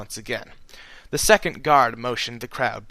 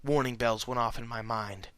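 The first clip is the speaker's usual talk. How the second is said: usual speaking voice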